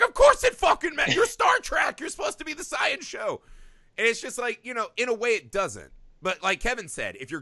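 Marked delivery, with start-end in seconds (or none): 0.0-3.4 high, loud outraged fan yell; furious fans. Retold or imitated. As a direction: imitate indignant fans shouting in protest. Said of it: "everyone was fucking furious".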